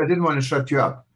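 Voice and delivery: normal talking voice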